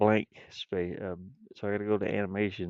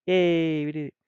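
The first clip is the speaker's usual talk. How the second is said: celebrating cheer; 7 semitones higher, 9 dB louder than usual. Real, not acted. Game maker finding the missing file, celebrating cheer. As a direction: cheer a small victory, higher and louder. Finally finds the blank image.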